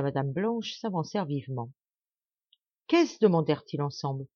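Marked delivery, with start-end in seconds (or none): 2.9-3.2 sharp high alarmed question; a jolt of alarm, pitched high; startled. A narrator's reading. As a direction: blurt it out in shock. Duke and wife alarmed.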